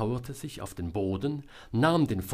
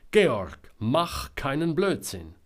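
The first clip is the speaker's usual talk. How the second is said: loud, raised warning shout; loud call; 5 semitones higher, 6 dB louder, slower than usual. A narrator's reading. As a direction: call out sternly at full voice. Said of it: "rief sie jetzt ganz laut". (ganz laut rufen)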